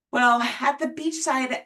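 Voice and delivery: ordinary delivery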